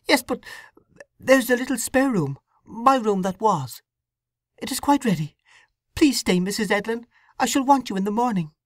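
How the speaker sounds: put-on a troubled young wife confessing; young woman Sue. Sue pleads to stay.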